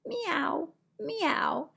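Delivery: high mewing meow; cat meow. Acted. Cat in farm picture book.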